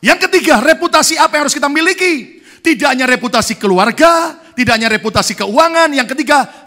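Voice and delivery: very loud, high preaching cry; full-force preaching. Acted. Pastor driving home his third point to the congregation, at full force. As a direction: preach it to the back row.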